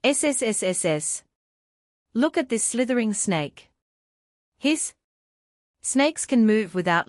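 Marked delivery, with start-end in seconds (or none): 0.1-1.2 long hissing s sounds, fading. Pretend. Snake sound for children. 4.6-4.9 high hissing snake sound. Pretend.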